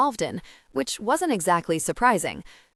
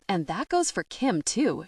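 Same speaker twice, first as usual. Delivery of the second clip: ordinary delivery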